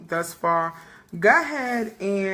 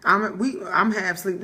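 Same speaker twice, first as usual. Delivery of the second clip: usual speaking voice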